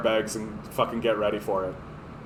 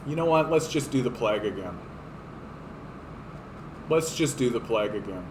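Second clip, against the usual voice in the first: quick, offhand and softer; casual earth voice; 3 semitones lower, 7 dB quieter, faster than usual. Pretend. The earth deciding on plague, quick offhand voice. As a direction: toss it off like a bored god. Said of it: "the earth goes".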